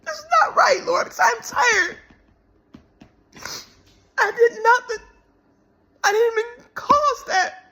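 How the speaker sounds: high, loud, breaking plea to God; crying out to the Lord, voice cracking high; tearful plea. Real, not acted. Woman tells viewers what she cried out to God, in a high tearful plea. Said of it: "I'm like".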